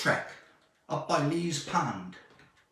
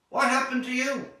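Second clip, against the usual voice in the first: alarmed question; 8 semitones higher, faster than usual. Acted. Father alarmed at injured son.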